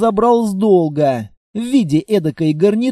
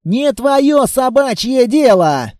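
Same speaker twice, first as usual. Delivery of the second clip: half-shouted retort, higher and drawn out; yelled back; 5 semitones higher, slower than usual. A narrator's reading. Looter yelling back at the sergeant, restrained yell. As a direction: fire it back angrily, stretching the words. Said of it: "заорал уже и я в ответ".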